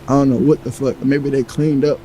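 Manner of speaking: normal talking voice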